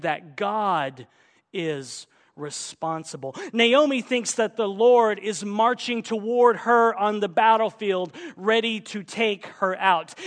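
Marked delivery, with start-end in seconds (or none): 3.5-10.1 raised, loud sermon delivery; forceful preaching. Real, not acted. Preacher declaring where Naomi errs, at full force. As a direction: hammer the point home to the congregation.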